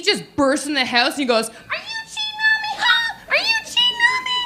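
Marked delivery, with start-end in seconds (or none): 1.5-4.3 shrieking falsetto accusation, far above his own voice; jealous lover's falsetto, way up high. Pretend. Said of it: "Gotta make her voice real high for that".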